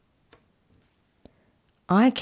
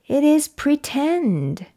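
ordinary delivery

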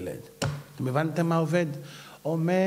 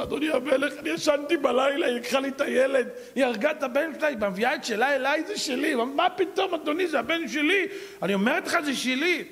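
pleading mother; 10 semitones higher than usual. Retold or imitated. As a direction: argue your claim in a raised voice. Mothers plead before the king.

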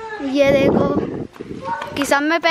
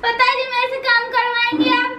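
high, fast cute witch voice; 7 semitones higher, much faster than usual. Pretend. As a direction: chatter fast as a sweet little witch. Girl playing good witch.

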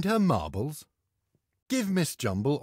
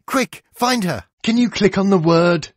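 excited; 4 semitones higher, 9 dB louder than usual. Acted. Tricky jumped away.

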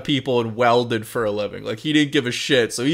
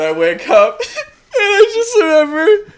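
laughing; 4 dB louder than usual. Real, not acted. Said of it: "laughing while telling".

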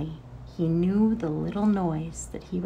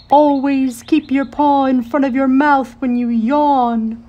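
a louder, higher owl voice giving advice; scolding owl; 6 semitones higher, 10 dB louder than usual. A narrator's reading. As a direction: lecture the rabbit as a fussy old owl. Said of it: "hooted the owl".

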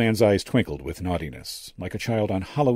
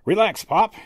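drawling; 13 semitones higher, 6 dB louder than usual. A narrator's reading. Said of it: "he drawled".

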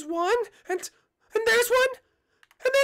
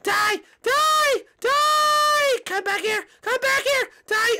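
loud, drawn-out battle shouts; murderous yelling; 5 semitones higher, 5 dB louder, much slower than usual. Acted. Peach chasing fans to kill them, angry shouting. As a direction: yell it like you're charging after them.

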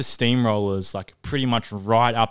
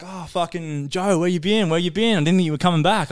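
surprised workmates; 7 semitones higher than usual. Retold or imitated. Workmates greeting him in surprise.

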